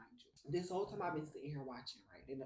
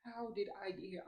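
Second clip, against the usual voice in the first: retold crying; 5 semitones higher, 4 dB louder, slower than usual. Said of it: "I'm crying".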